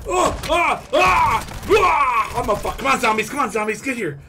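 loud, high yells; frantic shouting. Real, not acted. Gamer calls zombies in, frantic high shouting. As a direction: holler excitedly, high and loud.

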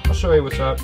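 ordinary talk, nothing marked

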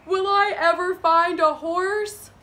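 play-acted touch of despair; mildly sad cowboy. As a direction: wail it lightly, as a storybook cowboy. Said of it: "was starting to get sad".

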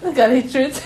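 breaking up with laughter mid-line; laughing through it. Real, not acted. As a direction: try to stay serious but crack up.

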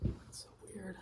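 breathy whispered aside, almost no voice. Real, not acted. Friend whispering her disbelief at the hospital, a hushed whisper. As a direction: whisper it under your breath.

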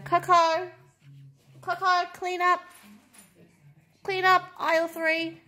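high, sing-song summons; playful calling out. Real, not acted. Calling Coco to clean up.

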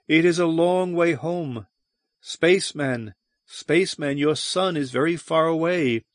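eerie distant voice. Acted.

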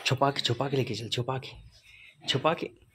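soft, secretive instructions; hushed urging. Real, not acted. Telling a partner to carry it hidden, hushed urging. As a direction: keep it low, like a co-conspirator.

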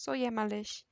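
plain reading voice

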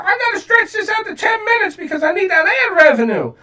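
high, far-off mocking voice, way up in pitch; mocking a greedy reactor. Mimicking other reactors who chase ad money, high mocking voice. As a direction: parody a whiny YouTuber milking views. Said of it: "every other reactor who's gonna be like".

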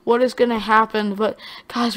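ordinary talk

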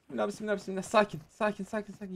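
soft anxious murmur to himself; calm yourself down under your breath; nervous murmuring. Real, not acted. Hiding from an enemy, murmuring a nervous prayer.

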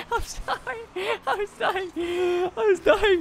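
apologising through real laughter; helpless laughing. Real, not acted. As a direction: try to talk while dying laughing. Cannot stop laughing after blunder.

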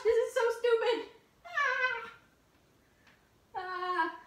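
frightened high yelp; panicked shriek on the box; scared yelp. Real, not acted.

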